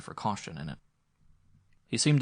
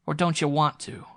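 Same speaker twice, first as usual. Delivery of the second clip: jeering taunt, pitched higher and louder; mock him with a raised, taunting question; 7 semitones higher, 7 dB louder than usual. A narrator's reading. Visitor jeers at Sturgis, raised taunting voice. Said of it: "and then he jeered".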